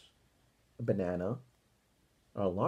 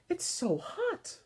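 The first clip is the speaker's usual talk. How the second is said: slow, much higher voice, muffled inside a costume; muffled through fur; slower than usual. A narrator's reading. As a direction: sound stuck inside a hot furry bear suit. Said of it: "whose voice flatted out from the fur".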